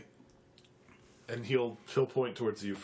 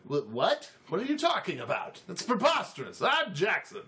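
high, squeaky outraged protest; halfling protesting a false accusation; indignant halfling; 9 semitones higher than usual. Acted. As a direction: sputter in offended disbelief. Halfling accused of fake name.

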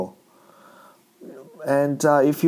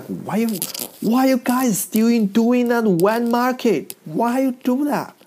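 high-pitched mock outrage; accusing complaint; 9 semitones higher than usual. Folks blaming Chinese people over the market, accusing. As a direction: whine the accusation, pitch jumping way up.

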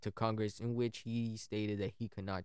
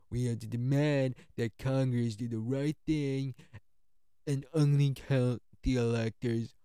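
Trump impression; 5 dB louder than usual. Narrator quoting Trump's speech to protesters, louder hammed-up Trump voice. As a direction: pump it up like Trump working a crowd. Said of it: "when I gotta do my Trump impression".

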